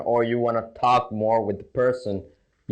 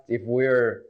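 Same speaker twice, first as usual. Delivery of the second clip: ordinary talk, nothing marked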